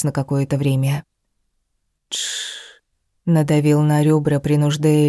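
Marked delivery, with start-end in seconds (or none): 2.1-2.8 breathy hush in the dark; shushing whisper. Acted. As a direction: whisper it to quiet her.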